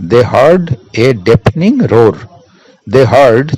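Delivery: very slow, deafening stretched; slow dictation. Shown as a demonstration. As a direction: read the answer slowly. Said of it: "dictating the answer".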